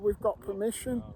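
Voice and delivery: ordinary talk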